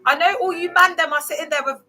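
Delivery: laughing shout at viewers; teasing laughing shout. Real, not acted. Teasing her viewers about having no tops on, loud laughing.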